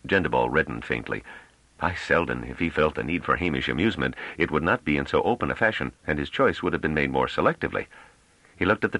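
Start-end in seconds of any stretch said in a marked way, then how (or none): none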